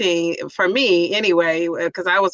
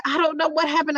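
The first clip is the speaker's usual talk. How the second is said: mild mock innocence; 6 semitones higher than usual. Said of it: "I'm gonna go with this".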